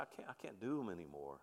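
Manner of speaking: low, hushed retelling of a crying man; soft and downcast, gently imitating his grief; retold tearful confession. Elderly man confessing to his pastor, quietly retold tearfulness. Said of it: "he's crying".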